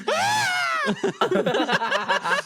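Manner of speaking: put-on piercing, very high kid scream; high screech. Kid screeches while grandpa clears the room, piercing shriek. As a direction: shriek in the back like a frantic kid.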